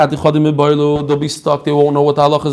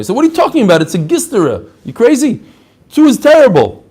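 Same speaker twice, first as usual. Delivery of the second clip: high, exasperated scolding; hammed-up outrage at a silly question; mock indignant yell; 6 semitones higher, faster than usual. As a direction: act the rebbe scolding the questioner. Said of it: "of course he yelled at them".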